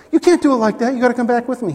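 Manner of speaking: hammed-up objection; mock protest.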